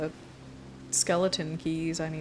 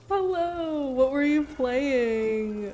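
high, slow sing-song greeting; high put-on voice; 7 semitones higher, much slower than usual. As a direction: stretch it out in a silly voice.

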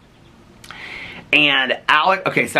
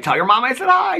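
high sing-out in passing; pitched-up imitated friendly call; retold cheery call. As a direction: re-enact calling a greeting across to a table. Calling to table in passing.

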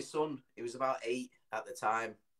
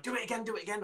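imitated giggly-eager kid, high pitch; excited son; 10 semitones higher, much faster than usual. Laughing son begging dad to repeat it, imitated excited kid. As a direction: beg for more in a high child's voice. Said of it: "He just went".

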